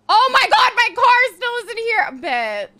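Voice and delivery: loud, high-pitched outburst; sudden loud exasperated complaint; exasperated yell. Real, not acted. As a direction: yell it out in annoyance. Annoyed at slow game.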